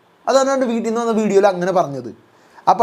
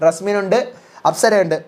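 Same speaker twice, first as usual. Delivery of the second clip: ordinary talk, nothing marked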